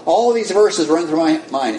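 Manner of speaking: ordinary talk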